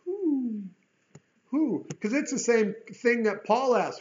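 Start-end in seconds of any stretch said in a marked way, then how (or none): 0.0-1.8 "who" lifted high and drawn out; stressed who. Shown as a demonstration. Preacher underlining the word "who", high and pointed. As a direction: hit the word "who" as a question. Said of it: "I would underline that".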